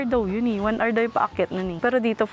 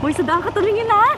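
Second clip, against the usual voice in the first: alarmed; 10 semitones higher, 4 dB louder than usual. Real, not acted. Scolding driver on bumpy road.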